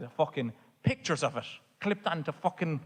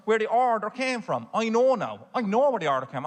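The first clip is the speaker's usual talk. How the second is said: indignant rant; 6 semitones higher, 4 dB louder than usual. Real, not acted. Rising indignation about Betty.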